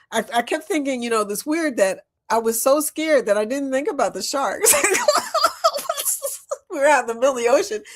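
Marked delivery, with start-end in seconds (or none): 4.8-6.7 laughing. Real, not acted. Laughing at scary memory.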